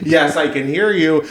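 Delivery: high-pitched mock yell; retold scream. Caller to the phone agent who can't hear, mock screaming. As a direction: act out straining to be heard on a call.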